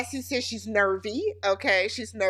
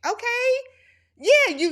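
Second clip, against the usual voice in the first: very high-pitched, skeptical response; sarcastic okay; 12 semitones higher, 4 dB louder than usual. Real, not acted. Recapper answering Destiny's boast, sarcastic. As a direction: pitch it way up, unimpressed.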